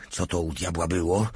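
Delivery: ordinary delivery, nothing marked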